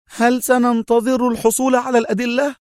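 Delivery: high-pitched indignant outburst; angry cry. A narrator's reading. Mona objecting to waiting for evidence, crying out angrily. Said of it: "صاحت في غضب".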